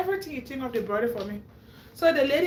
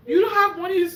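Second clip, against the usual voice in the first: shocked; 6 semitones higher, 7 dB louder than usual. Retold or imitated. Lady's shocked question retold.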